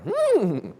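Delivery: high, loud hungry hum; a greedy "hmm" at the sight of food; a long, high "Hmm" eyeing dessert; tempted hum. Retold or imitated. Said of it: "I'm like".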